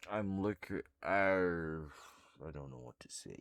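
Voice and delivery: slow, exhausted drag; barely awake, words dragging; too tired. Real, not acted. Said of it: "I'm too tired to think".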